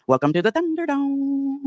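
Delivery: high, drawn-out mock welcome; mock announcer welcome. Streamer greeting the rival watching her, mock grand welcome. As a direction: stretch it out high like a ringside greeting.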